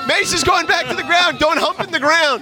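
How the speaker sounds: live announcer calling the action; play-by-play. Real, not acted. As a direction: call each move as it happens.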